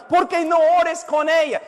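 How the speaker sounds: high, mock-pleading objection; protesting listener. Listener objecting to the preacher, in a high protesting voice. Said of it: "estás pensando".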